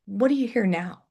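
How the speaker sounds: ordinary talk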